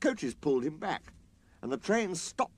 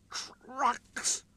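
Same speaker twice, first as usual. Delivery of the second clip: high, disdainful snort; 14 semitones higher than usual. A narrator's reading. Sir Handel scorning the quarry trucks. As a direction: snort the word in disgust. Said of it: "snorted Sir Handel".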